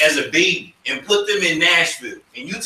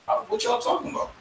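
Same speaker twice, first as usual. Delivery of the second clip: much higher, fast, softer baffled question; puzzled; 8 dB quieter, faster than usual. Acted. Church kid baffled by outsiders, high quick puzzlement. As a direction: toss it off confused.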